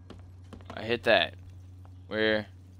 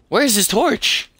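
much higher, louder frustrated cry; exasperated outburst; 12 semitones higher, 9 dB louder than usual. Real, not acted. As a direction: yell at the screen in frustration. Frustrated searching for torch.

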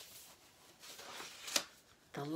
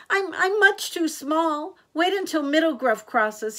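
high, loud small-goat voice; pleading little goat; 8 semitones higher, 6 dB louder than usual. A narrator's reading. Little Gruff talks the troll out of it, high pleading.

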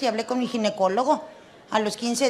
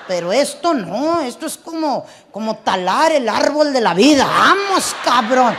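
shouted, much louder outrage; loud indignant protest; 9 dB louder than usual. Retold or imitated. Husband refuses the vasectomy, shouting in outrage. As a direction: bellow the protest like it's a tragedy.

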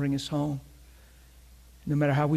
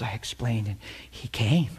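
normal talking voice

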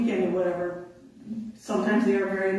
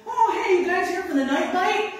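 much higher, friendly angler greeting; cheery fisherman; 11 semitones higher, 5 dB louder than usual. Retold or imitated. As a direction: chirp it like a clueless angler. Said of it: "and he's like".

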